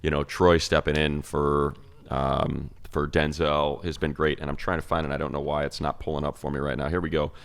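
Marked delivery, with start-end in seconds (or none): none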